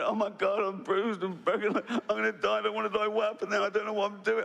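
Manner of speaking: hammed-up drowning panic; panicked survivor. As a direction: flail like you're drowning. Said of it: "you're going".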